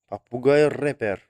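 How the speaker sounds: ordinary talk, nothing marked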